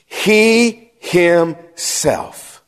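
"He himself" stretched very slowly, word by word; "He himself" in slow, separated beats; drawn-out emphasis. Real, not acted. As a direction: linger on each part of "He himself". Preacher emphasizes the verse's key words.

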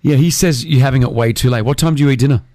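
ordinary talk, nothing marked

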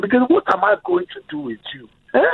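high, exasperated dad tirade; dramatic African dad. Retold or imitated. Son hears a dramatic rant from his dad, exasperated and high. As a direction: ham up a fed-up dad's lament. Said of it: "it's a performance".

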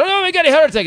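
very high, loud wail; hammed-up cry of dismay; mock panic. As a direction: squeal it in exaggerated alarm. Mock panic at heart attack news.

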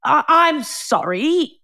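high, slow stammered apology; stunned, swooping high-pitched sorry; taken aback, imitated. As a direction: drag out a shocked apology, mimicking. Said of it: "taken aback and didn't know whether to".

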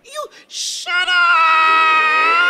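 slow, loud, eerie stretched shout; ghost scream. Acted. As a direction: drag out a monstrous shriek.

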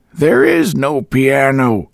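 put-on slow, high, cryptic old woman; enigmatic emphasis. A narrator's reading. Landlady denying the piano to Boyce, slow and cryptic. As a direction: weigh each word mysteriously. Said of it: "put an enigmatical emphasis".

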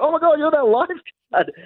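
high-pitched mock fan squeal; excited recognition. Excited strangers recognise him.

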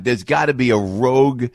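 usual speaking voice

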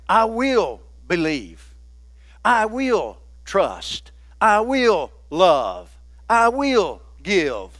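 slow, firm I will lines; a slow preacher's litany; slow declaration. Acted. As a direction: plant each I will deliberately. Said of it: "chanted resolutions".

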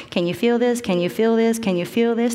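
brisk, repeated checking questions; routine checking. Retold or imitated. Doctor testing a patient's feet with a swab, routine checking.

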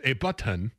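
button slowed, with released T, "but-TUN"; over-pronounce "a button" as "ay but-tun"; put-on accent. Shown as a demonstration. Co-host attempting enunciation, slow accented button. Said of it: "you threw an accent on it".

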